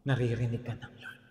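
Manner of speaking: low, soft and hushed; hushed reply. Acted. Pastor telling the doubting listener the Lord hears, low and hushed. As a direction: drop the voice low, a gentle aside. Answering each thought, hushed.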